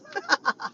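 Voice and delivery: high burst of laughter; laughing. Real, not acted. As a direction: break up laughing at your own story. Laughs at her own yelling story.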